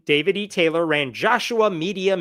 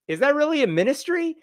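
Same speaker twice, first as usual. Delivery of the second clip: mock skeptical question; 10 semitones higher than usual. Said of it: "they were like".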